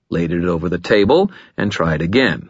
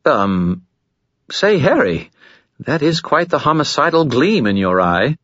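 higher, uneasy spirit; nervous skull; 8 semitones higher than usual. Acted. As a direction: notice the danger nervously.

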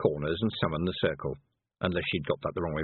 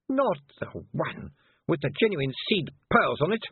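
higher, tightly controlled dismay; tight, appalled and higher-pitched; tight little voice; 9 semitones higher than usual. A narrator's reading. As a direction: hold the outrage in a small tight voice. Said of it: "in a tight little voice".

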